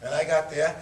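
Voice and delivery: usual speaking voice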